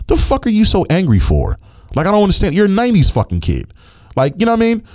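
high, exasperated outburst at the player; heated rant. Real, not acted. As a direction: voice climbing in disbelief and anger.

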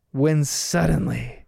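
slow, dramatic storyteller pause; deep dramatic voice. Retold or imitated. Dad cutting into the story with drama, slow deep voice. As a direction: build suspense like a dad telling a tale. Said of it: "says in a deep voice".

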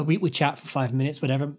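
usual speaking voice

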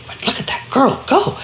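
hushed whisper of wonder; an awed storyteller's whisper. A narrator's reading. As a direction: breathe it out, amazed. Narrator marvels at the girl.

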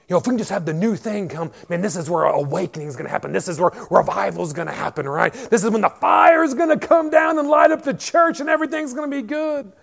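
fast, building to a high loud shout; mock revival hype. Pastor mimicking revival hopes with a building, fast shout. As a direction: whip it up into a frenzy of fake excitement. Parodying what the crowd hopes for.

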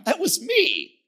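usual speaking voice

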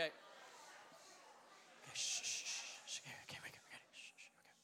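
whispered shh to quiet the room; soft whispered shushing; hushing whisper. Real, not acted. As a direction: hush everyone before the bit. Hushing crowd before the call.

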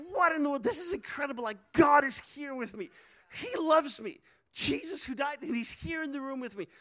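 high-pitched retold amazement; amazed disbelief. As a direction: marvel at it, voice lifted high. Retells amazed realization while crying.

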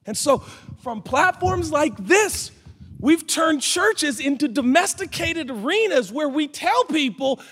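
high-pitched, insistent preacher's appeal; impassioned preaching. Real, not acted. Preacher pleading with church to accept mystery, impassioned. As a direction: preach it high, driving each point home.

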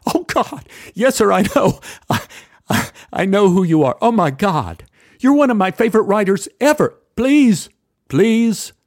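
high, loud, tumbling fast; starstruck gushing. Retold or imitated. Narrator gushes to Harold Pinter, excited and fast. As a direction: gush like a starstruck fan.

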